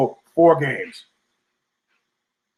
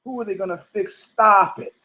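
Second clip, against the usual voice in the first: quieter, sneering echo of the question; scornful repeat; 2 semitones higher, 3 dB quieter than usual. Retold or imitated. Repeating the guy's question back at him with scorn. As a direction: throw his words back at him. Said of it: "repeats the quote mockingly".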